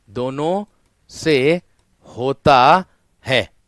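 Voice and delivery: slow, word by word, each word set apart; slow teaching emphasis. Shown as a demonstration. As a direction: spell it out slowly for the students. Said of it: "मुख से भी होता है और नाक से भी होता है". (धीरे-धीरे, हर शब्द अलग करके)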